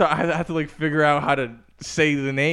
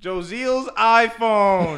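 slow, loud and higher; overenunciated command; 9 semitones higher, 4 dB louder, much slower than usual. Retold or imitated. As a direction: spell out each syllable loudly. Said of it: "I gotta scream everything".

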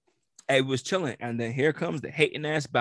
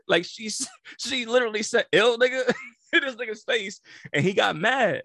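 laughing; 10 semitones higher, 6 dB louder than usual. Real, not acted. Laughing retelling the insult.

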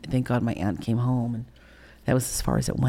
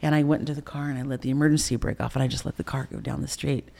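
ordinary talk, nothing marked

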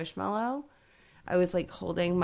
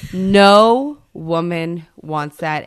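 louder repeat; 11 dB louder, much slower than usual. Real, not acted.

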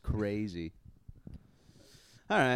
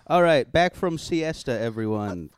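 drawn out like a host reopening the show; mock show host; 7 dB louder, slower than usual. Host welcoming everyone back, slow mock announcer.

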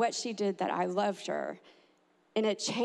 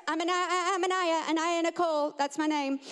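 put-on higher child voice, rattled off; hurried little girl; 6 semitones higher than usual. Girl correcting herself before the judge, high and hurried. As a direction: imitate a child blurting out her name. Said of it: "she tells her name real quick".

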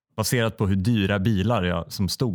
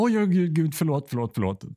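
flustered apology; 7 semitones higher than usual. Retold or imitated. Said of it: "Hon bara".

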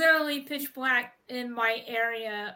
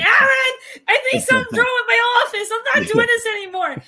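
high, fast panicked shouting; yelling in a panic; 11 semitones higher, 8 dB louder, faster than usual. Retold or imitated. Retold panic yelling to Aaron.

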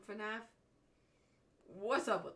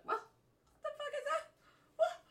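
high mock outrage; 5 dB louder, much faster than usual. Sister retells confusion at brother.

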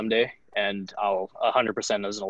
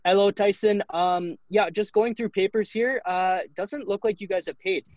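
lightly put-on French accent, pitched higher; imitating a French sales rep, voice lifted high; [name]'s French accent; 10 semitones higher than usual. French rep calling a player about unpaid gear, mock French accent. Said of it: "he's French".

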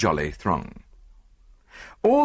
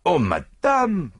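drawn-out, higher-pitched courteous objection; polite protest; 8 semitones higher, 4 dB louder, slower than usual. A narrator's reading. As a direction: protest gallantly with a foreign accent. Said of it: "protested Poirot".

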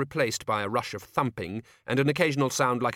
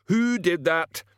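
much higher, loud demand; furious demand; 12 semitones higher, 6 dB louder, slower than usual. Acted. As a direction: bark the question twice. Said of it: "demanded Medium Dave".

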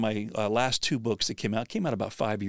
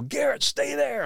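much higher, urgent shout to his son; half-retold yell of a father in the river; slower than usual. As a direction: call out across the water so he stays put. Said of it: "and I'm yelling".

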